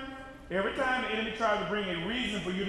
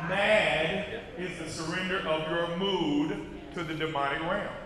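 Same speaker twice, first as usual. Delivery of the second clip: slow and deliberate; 6 semitones lower, 3 dB quieter than usual. Shown as a demonstration. Preacher repeating to the congregation, slow and deliberate. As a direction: lay each word down slowly. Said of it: "say it one more time".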